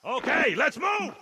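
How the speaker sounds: rallying shout. Acted. Sergeant calling the squad to move out, loud shout. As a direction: get them moving now.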